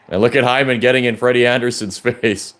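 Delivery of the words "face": usual speaking voice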